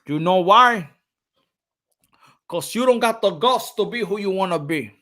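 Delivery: ordinary talk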